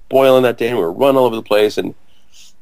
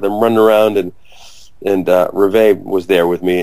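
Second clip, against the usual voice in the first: ordinary delivery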